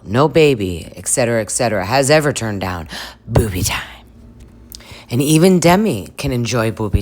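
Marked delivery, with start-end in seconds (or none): 3.3-4.0 hushed, voiceless reveal; whispered punchline. Acted. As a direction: whisper it like a sacred phrase. Hushed punchline after a list.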